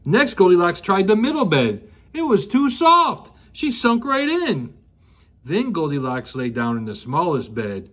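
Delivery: ordinary delivery, nothing marked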